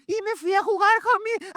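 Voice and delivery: very high mock-crying child voice; hammed-up squeal of a child in trouble; wailing child. Preacher as boy confessing to mother, mock wail.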